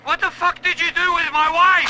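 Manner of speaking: high, loud, frantic demand; desperate husband yelling at the interrogator; furious demand. Acted. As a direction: yell it at your captor in panic. Prisoner demanding answers from captor.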